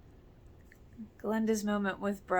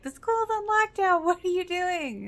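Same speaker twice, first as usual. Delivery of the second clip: much higher and louder, in a strained, shouting voice; angry Jimmy; 11 semitones higher, 5 dB louder than usual. Retold or imitated. Gunman confronting Lucas carrying Peyton, high angry yell. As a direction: yell it as a desperate, cornered gunman. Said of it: "and he's like".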